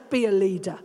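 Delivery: low-voiced husband impression; imitating a husband's unemotional command; stern husband. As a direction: drop low and give a curt order. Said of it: "he goes".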